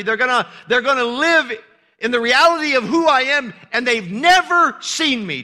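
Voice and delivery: loud, high, fervent preaching; preaching at full force. Real, not acted. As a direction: preach it with full fire to the congregation.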